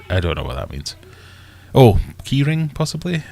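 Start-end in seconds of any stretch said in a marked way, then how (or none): 1.7-3.2 sudden high realisation; realising. Real, not acted. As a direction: figure it out out loud, rising. Working out a misreading.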